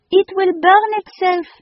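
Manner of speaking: put-on high, loud French-accented alarm; alarmed French lady. A narrator's reading. The lady fears the phoenix will burn, high anxious French accent. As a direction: warn in a French accent, frightened.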